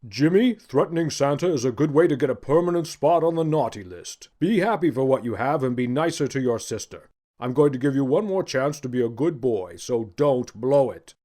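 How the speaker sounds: Santa voice, firm and warning; a jolly man turned strict; stern Santa. Acted.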